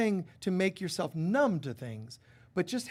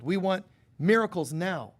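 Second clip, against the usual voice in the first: ordinary talk, nothing marked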